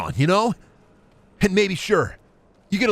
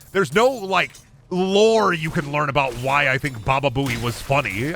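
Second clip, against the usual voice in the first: normal talking voice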